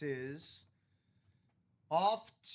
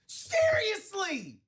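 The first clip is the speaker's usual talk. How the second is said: laughing disbelief; 14 semitones higher, 7 dB louder, much slower than usual. Real, not acted. Disbelief at the roll.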